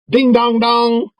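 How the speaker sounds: high, slow ding-dong like a ship's bell; bell imitation. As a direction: ring it out like a boat bell. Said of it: "he would shout again and say".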